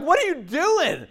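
high-pitched, drawn-out disbelief; acting out an appalled bystander; incredulous challenge. Bystander confronting a man abusing his dog, incredulous.

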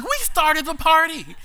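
high, slow delighted shout; gleeful echo. Retold or imitated. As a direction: echo him back in giddy glee. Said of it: "And I'm like".

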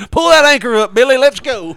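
high, loud urgent shout; shouted captain order. Pretend. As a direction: yell it to the crew. Captain fleeing port.

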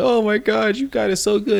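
high-pitched pleading wail; mock envious lament. He falls to his knees before T J, mock high lament. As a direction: plead it high, hamming up the envy.

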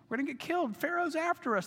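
the fear breaks upward into a shrill-free high plea; mock panic. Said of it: "They are in a panic".

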